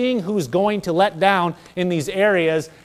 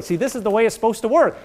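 ordinary delivery

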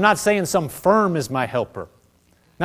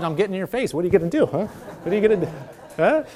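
mock taunt; 4 dB quieter, faster than usual. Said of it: "We would say this in college".

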